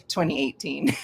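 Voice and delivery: put-on British pronunciation; British accent. Shown as a demonstration. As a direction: say "twenty eighteen" Britishy, playfully. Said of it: "You have to say it Britishy".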